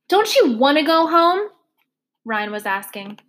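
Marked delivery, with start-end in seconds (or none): 0.1-1.5 higher and louder, a mildly coaxing policeman; lightly voiced officer. Officer Ryan coaxing the silent girl, lightly voiced. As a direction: ask it like a puzzled cop.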